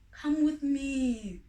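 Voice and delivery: slow, pleading call from the shadows; father bird urging family to come; coaxing stranger. Acted. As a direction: lure them out gently. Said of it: "the voice said".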